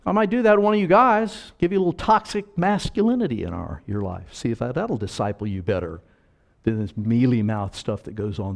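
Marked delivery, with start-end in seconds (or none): none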